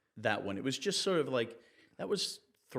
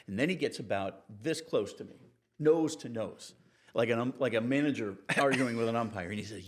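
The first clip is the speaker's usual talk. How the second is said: ordinary talk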